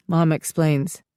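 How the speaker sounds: ordinary delivery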